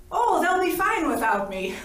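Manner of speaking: high, loud, playful brush-off; breezy dismissal. Retold or imitated. Retold argument with God, brushing off.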